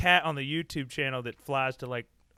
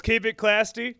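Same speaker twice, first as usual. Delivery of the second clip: louder, punchy catchphrase; hammed-up sign-off; 6 semitones higher, 6 dB louder, slower than usual. Closing the podcast with the new catchphrase, punchy. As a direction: sell it like a show's closing line.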